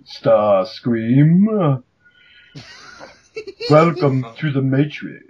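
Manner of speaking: slow, measured Agent Smith drawl; imitating Agent Smith greeting Starscream; Agent Smith impression. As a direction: deliver it like a cold program agent. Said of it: "Agent Smith basically just go".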